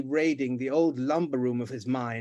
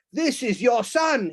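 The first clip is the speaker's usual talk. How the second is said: slow, loud and higher boom; 9 semitones higher, 6 dB louder, slower than usual. Acted. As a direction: boom it out grandly. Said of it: "he boomed out".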